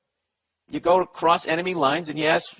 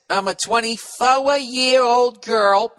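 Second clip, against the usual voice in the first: put-on much higher, slow, drawn-out girly voice; mock young woman; 8 semitones higher, 5 dB louder, much slower than usual. Host mocking a listener's letter, much higher and slow girly voice. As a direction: read her words back in a mocking girlish voice. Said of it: "She says".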